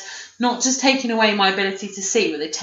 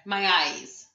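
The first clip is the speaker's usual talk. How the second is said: softer mock lament with a laugh; imitating a man's complaint; 5 dB quieter than usual. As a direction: lightly mimic his complaining, holding back volume. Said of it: "Did you hear him going on about his eyes".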